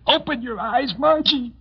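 high, frantic pleading; desperate plea. Acted. Husband begging his dying wife, high and desperate. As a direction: beg her to wake up.

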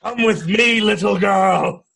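put-on loud, high, drawn-out call to a child; hammed-up holler, stretched at the end; mock calling out. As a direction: call it out big and slow, hammed-up. Voices a line at the child.

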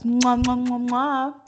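blown kisses into the mic; between kisses. Real, not acted. Signing off with kisses.

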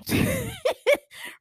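quick high cackle; high laugh. Real, not acted. Amused by Robin showing up, a high laugh.

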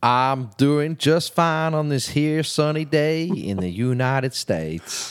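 slow, drawn-out Southern US drawl; put-on American accent. Brit greeting podcast host from the States, hammy Southern US accent. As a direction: lay on a hammy Texan drawl. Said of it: "that stupid accent".

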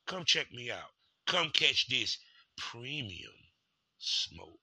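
low, soft sales pitch; smooth promo voice. Acted. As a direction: pitch it like a late-night ad.

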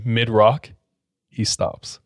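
usual speaking voice

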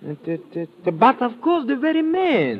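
stumble, then recognize him with a start; surprised stammer. Acted. Recognizes the man in photo.

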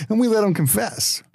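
high-pitched mock cop punchline; [name]. Senior cop telling Jay about gym interrogations, cocky. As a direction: deliver it as a proud cop's trade secret. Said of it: "That's what he's telling Jay".

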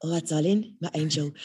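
a hint of breathy London girl; breathy London accent. Imitating London friend's endearments, breathy London voice. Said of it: "girls with the breathy London voices".

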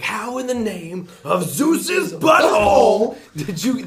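high, loud, drawn-out outburst; full-blown mock freak-out; freaking-out Nick Cage. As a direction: shout it slowly, completely losing it. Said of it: "Freaking out".

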